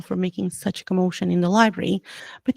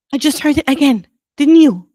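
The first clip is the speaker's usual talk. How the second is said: loud, urgent question; 5 semitones higher, 7 dB louder than usual. A narrator's reading. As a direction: press them urgently. Harry urgently hears the voice.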